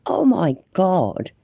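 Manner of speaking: can't believe what she's watching, laughing it off; incredulous outburst. Real, not acted. Reacting in disbelief to clip.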